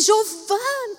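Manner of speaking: high-pitched call of a name. Retold or imitated. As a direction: call her across the street. Calling her daughter's name.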